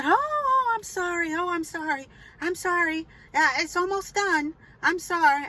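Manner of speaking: high-pitched, gushing string of apologies; apologetic neighbor. Retold or imitated. Upstairs neighbor apologizing for the water, high mock-apologetic. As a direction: mimic her in a high voice, starting loud.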